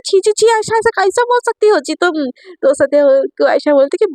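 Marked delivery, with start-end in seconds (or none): 0.0-2.2 higher-pitched, offended scolding; mildly acted shocked disgust, pitched up; scandalised protest. A narrator's reading. Satya recoils at Ayesha's teasing, scandalised.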